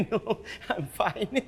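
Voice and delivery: soft, laughing reply; laughing demur. Acted. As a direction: brush it off with a light laugh. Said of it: "she demurred".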